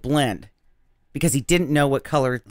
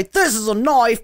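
higher, hammed-up Australian accent; Crocodile Dundee; 5 semitones higher than usual. Shown as a demonstration. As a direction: attempt a bad Australian accent. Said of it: "accent from Crocodile Dundee".

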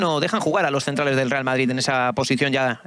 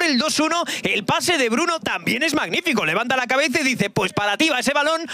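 breakneck goal commentary; 12 semitones higher than usual. Real, not acted. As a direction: scream the goal, stretching every gol. Goal scored, commentator erupts.

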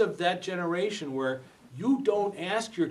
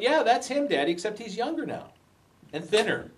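put-on little girl; 8 semitones higher than usual. Small daughter answers her father.

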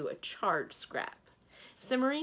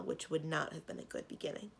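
usual speaking voice

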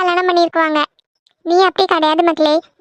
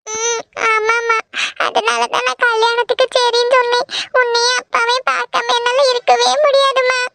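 high, tearful daughter's voice; weepy, clinging confession to a mother; 4 semitones higher than usual. Acted. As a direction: plead through tears, pitch raised. Tearful daughter reassures her mother.

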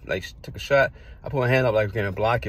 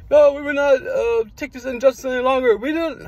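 much higher, louder rallying protest cry; Jesse Jackson; 7 dB louder than usual. Retold or imitated. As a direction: proclaim it like a civil-rights orator at a rally. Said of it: "pretend I was, like, Jesse Jackson".

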